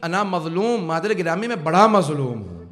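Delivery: high lamenting preacher's cry, dropping at the end; impassioned lament. Acted. Preacher quotes the wronged child to his mother, lamenting. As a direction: cry it out to the congregation, building to the last phrase.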